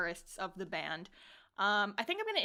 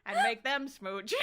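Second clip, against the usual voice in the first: ordinary talk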